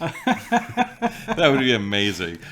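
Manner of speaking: high, slow, laughing delight. Real, not acted. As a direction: gush it through a laugh. Excited laughing reaction.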